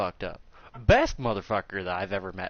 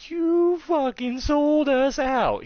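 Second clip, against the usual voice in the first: high, drawn-out, loud accusation; mock betrayed outcry; 5 dB louder, slower than usual. Students accusing their master of selling them out, drawn-out high outcry. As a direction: squeal the betrayal, stretching each word.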